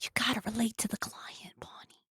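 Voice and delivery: hushed conspiratorial whisper; a quiet aside to Bonnie. Acted. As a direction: lean in and whisper it like a secret. Confiding aside about the client.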